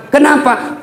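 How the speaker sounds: usual speaking voice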